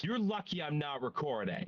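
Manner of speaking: ordinary talk, nothing marked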